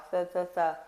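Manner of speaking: th, th, th as a soft hiss through the teeth; unvoiced th. Shown as a demonstration. Teacher isolating the voiceless th sound. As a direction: blow a voiceless th out. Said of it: "o som do T H".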